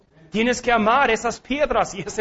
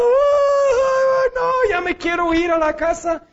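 higher, mock sobbing child; crying boy; 11 semitones higher, slower than usual. Preacher imitating himself crying at his first wrestling match, mock sob. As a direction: whimper like a kid who wants to go home. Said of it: "Y yo estaba llorando".

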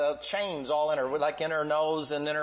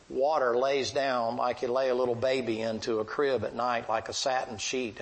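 ordinary talk

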